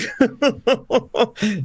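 laugh. Real, not acted. Laughing at own remark.

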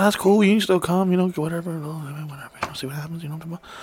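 starts softly and fades to a near whisper; fading to a whisper. Retold or imitated. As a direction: let it sink into a conspiratorial whisper. Retelling his own quiet pitch to a girl.

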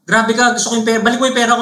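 imitating the classmate demanding a refund; angry classmate. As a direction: rattle it off like a furious kid. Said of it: "galit na galit yung kaklase ko".

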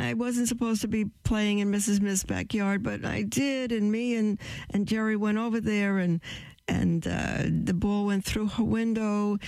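put-on high child's voice, confessing; little Johnny. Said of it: "little Johnny comes home, and he says".

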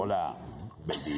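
seductive greeting. Shown as a demonstration. Showing how a seducer greets, low and smooth. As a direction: deliver the line like a smooth seducer. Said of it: "¿Cómo tenía que ser?".

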